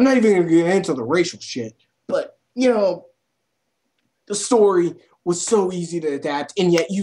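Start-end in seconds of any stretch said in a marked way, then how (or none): none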